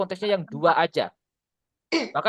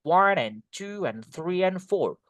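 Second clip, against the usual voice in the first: slow beat on "One", "two", "three", "four" with a plain "and" between; stressed number beats; slower than usual. Shown as a demonstration. Teacher building up the rhythm, numbers stressed over "and". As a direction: lean on each number, linking with a short "and". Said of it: "So, you can say".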